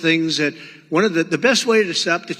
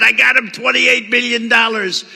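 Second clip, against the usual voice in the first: boastful retort; 6 semitones higher, 5 dB louder than usual. Retold or imitated. Retelling his boast.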